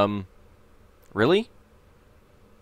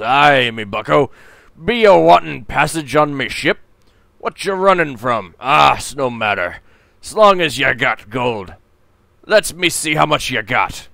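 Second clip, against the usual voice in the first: louder, slurring pirate brogue; a tipsy sea captain sizing up a passenger; drunken pirate captain; 3 semitones higher, 8 dB louder than usual. Acted. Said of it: "drunkenly says".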